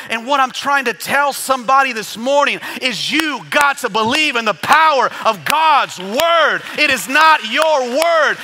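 high, loud, emphatic sermon cry; full-force preaching. Real, not acted. Preacher telling somebody to believe God's word, full-force preaching. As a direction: drive it home to the congregation at full voice.

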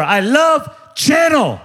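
high, slow, shouted gush over the brand; gushing shopper. Retold or imitated. Pastor mimicking himself gushing over Chanel in a store, high slow shout. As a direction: rave about the store like a starstruck fan.

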